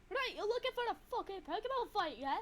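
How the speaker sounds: put-on a light Scottish accent, challenging; Scottish trainer picking a fight; mild Scottish voice calling out a trainer. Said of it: "the Scottish Pokemon trainer".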